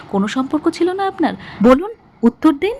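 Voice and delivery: high, loud demand for an answer; heated demand. A narrator's reading. Sudipa interrogates Aditya about the relationship, heatedly demanding. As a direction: raise the voice and press hard.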